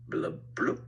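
low, soft blub blub; bubbling. Pretend. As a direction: make underwater bubble sounds. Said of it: "How about an octopus?".

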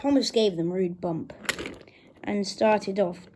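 ordinary delivery

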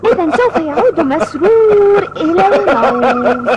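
slow, giggling reply; cheeky boy laughing at his own threat. Acted. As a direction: laugh through the joke. Boy jokes back, laughing.

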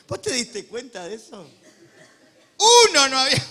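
loud, high, laughing sermon cries; booming, amused pulpit shouting; full-force preaching. Acted. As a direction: hammer each name out with glee.

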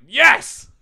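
loud, insistent mock yell; retold shouted yes. Suitor pushing the proposal, loud mock yell. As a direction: bark the yes over her. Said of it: "And he's like".

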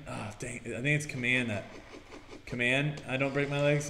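ordinary delivery

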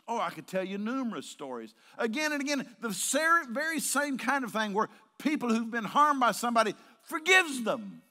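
loud, high, impassioned sermon delivery; full-force preaching. Real, not acted. Preacher promising numerous stories of forgiveness, loud and impassioned. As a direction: preach it loudly, dropping pitch on the last word.

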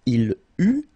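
"il, u" ending on French u, no T; loud, clear "il, u" with an open vowel end; silent final T. Shown as a demonstration. Teacher modelling a verb form, silent final T. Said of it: "final T not pronounced".

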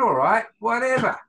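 high, loud mock indifference; dismissive shrug. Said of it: "And I thought".

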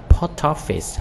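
POST OFFICE run together, t carried into "office"; linked POST OFFICE. Shown as a demonstration. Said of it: "nói âm qua".

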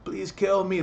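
high, hushed begging; a man begging police to kill him; imitated desperate plea, soft and high; pleading. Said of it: "he told the cops to please kill him".

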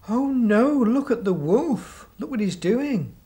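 high, loud alarmed cry. Acted. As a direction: gasp it out in alarm. Teller acts a startled onlooker.

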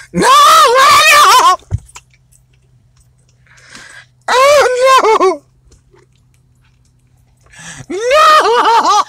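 fake-sob the no's over a lost game; mock crying. Dad losing a game to his kid, hammed-up fake crying.

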